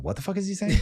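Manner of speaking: high, laughing mock confusion; baffled Greek local. Host imagines a real Greek hearing his accent, high mock bafflement. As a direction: play a bewildered Greek listener. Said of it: "a real Greek person right now is going".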